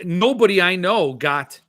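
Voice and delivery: normal talking voice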